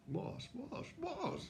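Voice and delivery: climbing shocked squeaks of disbelief; stunned disbelief. Retold or imitated. Retold shock at This Is Your Life.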